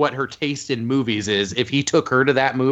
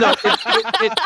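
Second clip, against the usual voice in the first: laughing. Real, not acted. Laughing at friend's joke.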